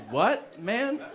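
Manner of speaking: loud, high rising protest; mock offended disbelief. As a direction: act stunned, pitch climbing sharply. Retold shock at doctor's answer.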